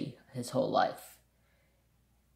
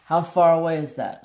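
neighbor's question, higher and much louder; loud doubtful question; 4 semitones higher, 11 dB louder than usual. Retold or imitated. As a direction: call it out in disbelief.

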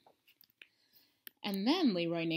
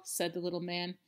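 plain reading voice

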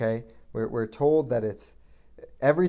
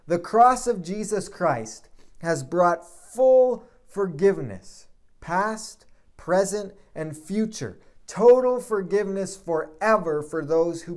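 emphatic preaching; 3 semitones higher, 5 dB louder, slower than usual. Acted.

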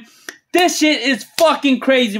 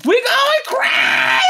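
ecstatic screech; 13 semitones higher than usual. Real, not acted. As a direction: screech it out, stretching the last word. Peak of celebration over lucky pulls.

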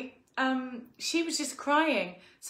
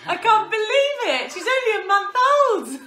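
laughing; 8 semitones higher, 9 dB louder than usual. Retold or imitated. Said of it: "And I'm like".

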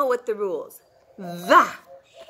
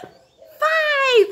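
mock gasp; 11 semitones higher than usual. Delighted correct answer.

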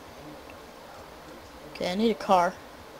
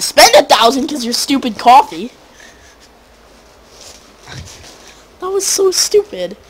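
annoyed rant; 7 semitones higher, 11 dB louder than usual. Real, not acted. Complains about wasted money.

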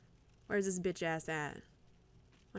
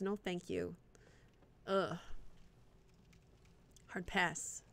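usual speaking voice

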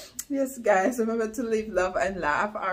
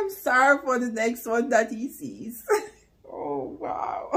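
ordinary talk

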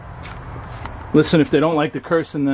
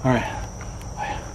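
whispering; 8 dB quieter than usual. Real, not acted. Alone in scary house.

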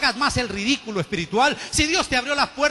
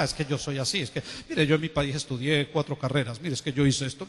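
mocking a braggart in a much lower, quieter put-on voice; boastful believer; 9 semitones lower, 6 dB quieter than usual. Pastor mocking churchgoers who boast of degrees, low mock bragging. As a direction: sneer it out like a self-important show-off. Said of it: "Entra por una puerta y dice".

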